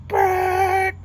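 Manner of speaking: high, loud trilled "brrrt"; imitated truck screech. Storyteller imitating a pickup pulling up, loud screech. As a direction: mimic brakes slamming with your voice.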